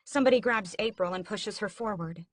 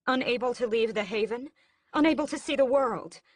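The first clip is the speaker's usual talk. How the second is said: bitter outburst; 7 semitones higher, 5 dB louder than usual. A narrator's reading.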